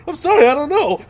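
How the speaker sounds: high, loud, panicky mock apology; hammed-up helpless apologizing, voice shooting up; flustered apology. Acting out own flustered apologizing.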